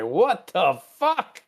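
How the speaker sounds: slow, drawn-out shocked curse; stunned outburst. Retold or imitated. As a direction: let it fall out in disbelief. Spock sees himself human.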